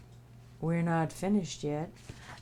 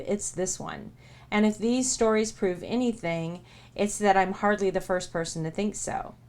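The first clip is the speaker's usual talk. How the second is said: steady book-reading voice; earnest narration of a book preface; reading aloud. Acted. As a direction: read the preface like an audiobook.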